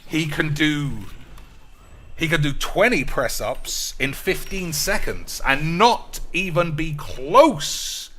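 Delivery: usual speaking voice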